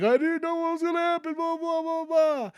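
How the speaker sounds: high, fast whiny voice brushing it off; mock the brother's lame excuse. Brother excusing himself to Ahsoka, high whiny mock voice. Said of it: "the brother's like".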